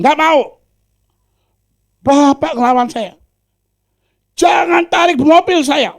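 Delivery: loud, high, furious shouting refusal; angry refusal. Acted. Preacher shouting at the debt collector, furious. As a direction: shout him down, don't give up the car.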